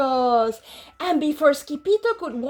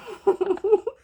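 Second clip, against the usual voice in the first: laughing; 6 semitones higher than usual. Real, not acted. Reader laughs at the story.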